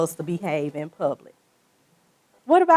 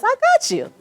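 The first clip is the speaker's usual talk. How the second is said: fast, raised country Mississippi twang; 4 dB louder than usual. Retold or imitated. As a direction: belt it out in country Mississippi talk. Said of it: "use my country Mississippi voice".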